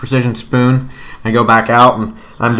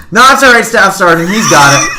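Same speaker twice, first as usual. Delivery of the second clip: much higher, loud call across the yard; first sergeant yelling down from the window; shouting from window; 10 semitones higher, 7 dB louder than usual. Retold or imitated. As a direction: holler it out a window at a distance.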